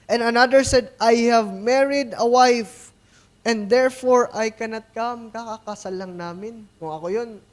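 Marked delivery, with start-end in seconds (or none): 0.1-5.3 booming Bible reading from the pulpit; scripture reading. Acted. Reads scripture aloud.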